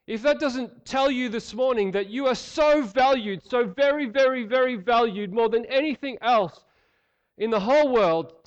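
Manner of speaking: high, loud impassioned preaching; preaching at full force. Acted. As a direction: build it up and proclaim it to the congregation.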